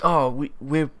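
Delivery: put-on aborted, uncertain German accent; attempted German accent. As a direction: have a half-hearted go at a German accent. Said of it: "I don't know what accent I was gonna do".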